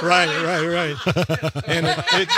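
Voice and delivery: cracking up while agreeing; laughing agreement. Real, not acted. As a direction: agree loudly through real laughter.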